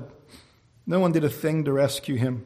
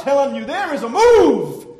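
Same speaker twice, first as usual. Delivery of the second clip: high, loud, slowing on the last words; insistent shout; 8 semitones higher, 9 dB louder than usual. Real, not acted. Preacher urging the doubter that hope remains, shouting. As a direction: drive it home at full voice.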